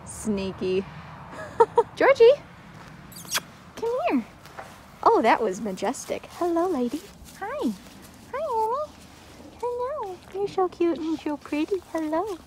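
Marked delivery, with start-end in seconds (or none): 2.0-4.2 much higher, loud call to the dog; high laughing call to Georgie. Real, not acted. Calling the dog over. 6.3-12.4 much higher, cooing at the horse; high sing-song horse talk. Real, not acted. Greeting horse Annie.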